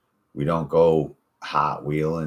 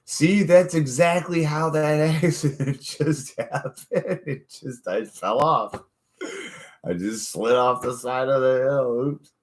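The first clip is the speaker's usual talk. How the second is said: mock injured guy; 9 semitones higher than usual. Acting out the injured man explaining.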